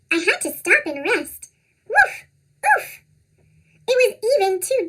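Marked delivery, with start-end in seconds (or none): 1.9-2.2 high squeaky dog bark; squeaky cartoon voice. Dog barking in snow. 2.6-3.0 squeaky cartoon voice. Sinking in deep snow.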